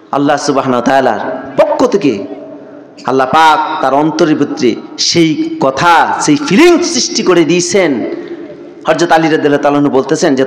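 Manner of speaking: ordinary talk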